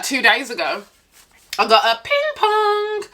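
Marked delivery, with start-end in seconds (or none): 2.0-3.0 high, sustained doorbell chime; drawn-out mock chime; imitated doorbell. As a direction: sing out a slow two-note doorbell. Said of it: "on my doorbell".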